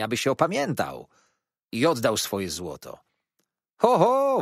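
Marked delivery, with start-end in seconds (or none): 3.8-4.4 high, loud jeering hoot; mocking call. A narrator's reading. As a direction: hoot like taunting street boys. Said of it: "wołali".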